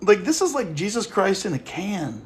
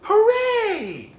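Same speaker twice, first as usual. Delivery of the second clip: mock cheer; 6 semitones higher, 4 dB louder than usual. Mocking the church placating pagans.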